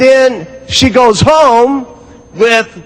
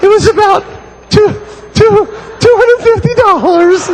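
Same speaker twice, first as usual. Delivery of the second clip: much higher, hesitant mock wife; high, faltering confession; timid wife; 12 semitones higher than usual. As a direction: stammer the price out meekly. Wife admits the price.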